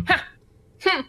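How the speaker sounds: short scoffing "ha" and "hmm"; demonstrated huff of disdain. Shown as a demonstration. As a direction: try out a dismissive scoff. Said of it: "Scoff sound like?".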